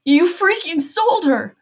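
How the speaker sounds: high, drawn-out accusing scream; loud, slow, high-pitched cry of betrayal; anguished scream. A narrator's reading. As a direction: scream it from deep in the gut. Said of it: "from somewhere deep in his gut, screamed".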